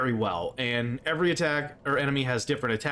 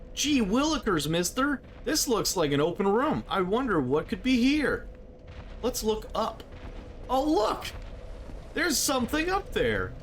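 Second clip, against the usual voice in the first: put-on wholesome 1950s kid; 7 semitones higher than usual. Playful kid voice exploring room.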